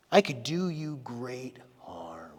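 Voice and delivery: breathy threatening whisper, slow; menacing whisper. Retold or imitated. Laban whispering a threat to Jacob, menacing. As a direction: hiss the threat softly and slowly.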